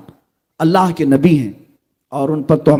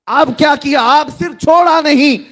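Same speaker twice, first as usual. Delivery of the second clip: excited rhetorical question; 9 semitones higher than usual. Real, not acted.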